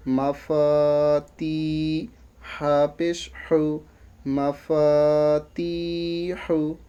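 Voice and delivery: measured drill, drawing out ফা and তি; slow spelling demo. Shown as a demonstration. Teacher showing pupils how to spell slowly, deliberate syllable-by-syllable recitation. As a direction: spell each syllable, then join them with long held vowels. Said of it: "আস্তে আস্তে উচ্চারণ করবেন".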